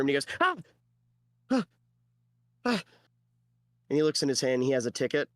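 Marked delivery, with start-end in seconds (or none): 0.4-2.8 short, high goblin yelp. Acted. Small goblin popping into the afterlife office, yelping. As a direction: yelp in separate little bursts. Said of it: "a small goblin plop into the room".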